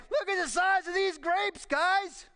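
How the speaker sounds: high, excited southern twang. Acted. Spies showing off giant grapes, excited southern twang. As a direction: whoop it up with a little southern twang. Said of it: "a little southern twang in there".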